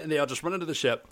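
ordinary talk

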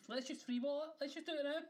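loud, high, fired up; rally them to just go; excited. Real, not acted. Hyping up co-host to start the show, loud and high.

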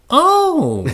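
very high, drawn-out swooping oh; exaggerated "oh, how interesting"; mock impressed reaction. Boyfriend reacts to her job, high exaggerated oh. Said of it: "this is his reaction".

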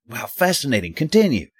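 high, sarcastic Southern twang; mock Oklahoman accent. Reporter mocking the painter's bored reply, Southern accent. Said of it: "do my impression of him going".

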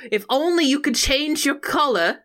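high, mock-lovelorn stage speech; Juliet's soliloquy. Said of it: "A, or soliloquy, if you will".

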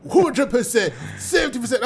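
mock Villa fans in a hint of a London accent; imitating excited London fans, half laughing. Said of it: "Villa fans speaking with a London accent".

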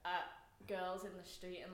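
ordinary talk

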